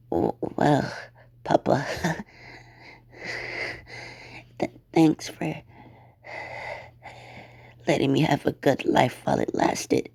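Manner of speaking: weak, halting voice with breaths between phrases; dying words. Acted. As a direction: gasp out last words to your papa. Dying girl's last words.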